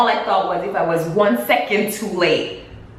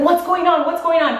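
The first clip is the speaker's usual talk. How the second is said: raised pitch, quick urgent repeat; mildly alarmed retold question; 8 semitones higher than usual. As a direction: imitate a worried man demanding answers. He catches up as she flees.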